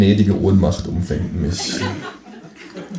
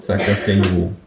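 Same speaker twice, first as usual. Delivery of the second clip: ordinary delivery, nothing marked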